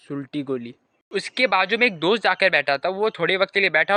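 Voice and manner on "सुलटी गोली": low, soft deadpan aside; murmured catchphrase. Acted. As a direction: drop it quietly as a punchline. (धीमी, नीची आवाज़ में punchline)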